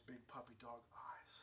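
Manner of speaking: unvoiced, wheedling hush; whisper. Preacher whispering how daughters plead with dad.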